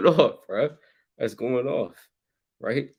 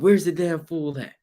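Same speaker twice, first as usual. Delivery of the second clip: raised, higher demanding shout; mock yell; 6 semitones higher than usual. Husband yelling at wife for food, mock yell. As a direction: bark the question across the house. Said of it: "You yell out".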